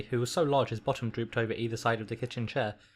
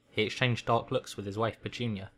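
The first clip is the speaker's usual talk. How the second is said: ordinary delivery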